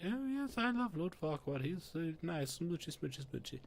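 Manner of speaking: mocking Fiona gushing over her crush; mock swooning princess. Mocking Princess Fiona gushing about Lord Farquaad, high and swoony. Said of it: "and she's all like".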